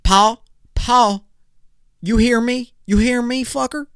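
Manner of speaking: high mock Southern twang calling Pa; Texan son calling Pa. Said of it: "I started calling my dad, Pa".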